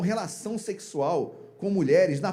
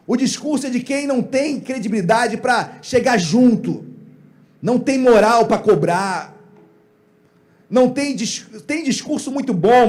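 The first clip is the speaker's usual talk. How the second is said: higher, loud and punchy sermon delivery; emphatic preaching; 5 semitones higher, 5 dB louder than usual. Real, not acted. Preacher insisting Eli knew about his sons, emphatic preaching. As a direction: drive it home to the congregation.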